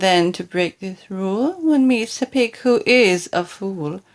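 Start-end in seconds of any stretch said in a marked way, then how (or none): none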